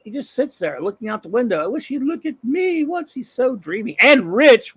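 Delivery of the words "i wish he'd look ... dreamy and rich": high, lovestruck woman's voice; swooning woman. Acted. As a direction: sigh it like a smitten secretary. Voicing a swooning secretary.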